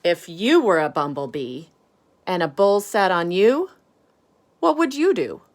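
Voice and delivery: lively storybook voice, big swings up and down; animated read-aloud for a class; reading to children. A narrator's reading. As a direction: read it out like bedtime story time for little ones. Reads picture book to children.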